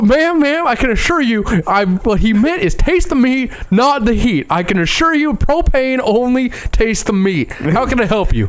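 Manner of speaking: high, slow put-on salesman Texan; mock propane salesman pitch; Hank Hill voice. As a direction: reassure the customer like Hank. Voicing Hank to a customer.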